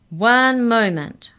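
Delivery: high, slow, booming command; loud, commanding. A narrator's reading. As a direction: halt them with a loud, drawn-out order. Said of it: "The voice was loud, commanding".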